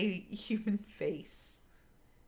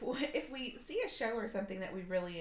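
ordinary talk

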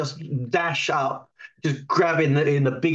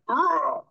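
effort roar; 11 semitones higher than usual. Acted.

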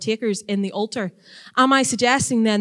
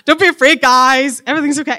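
high, loud, breezy mock reassurance; jokily chirpy angel, pitched up; mock casual reassurance; 5 semitones higher, 9 dB louder than usual. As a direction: brush the fear off in a louder, higher put-on voice. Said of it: "It's not because they're just like".

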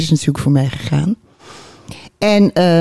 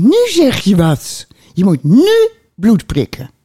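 doctor voice; 5 semitones higher, 3 dB louder than usual. Retold or imitated. Doctor quoted, urgent.

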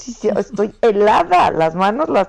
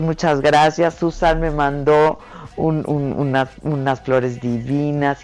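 warm; 7 semitones lower, 4 dB quieter than usual. Real, not acted. Thanking people softly.

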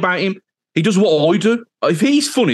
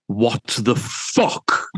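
What the fuck drawn out slowly, leaning hard on fuck; stress on fuck; 2 semitones lower, much slower than usual. Shown as a demonstration. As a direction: stretch What the fuck out and punch fuck. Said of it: "I'll try and put emphasis on that word when I get to it".

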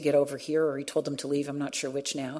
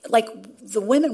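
normal talking voice

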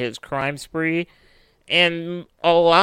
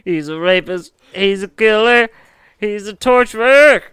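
rising, louder with each line; building outrage; 5 semitones higher, 6 dB louder than usual. Acted. Escalating list of crimes.

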